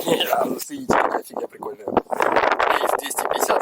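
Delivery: high excited squeal. Real, not acted. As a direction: squeal over the find. Excited find, pitched way up.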